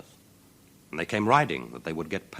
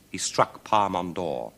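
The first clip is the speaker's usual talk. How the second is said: plain reading voice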